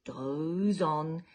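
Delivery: lively picture-book storytelling; reading to children. A narrator's reading. Storyteller reading to children, lively and sing-song. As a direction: read it like bedtime story time for little ones.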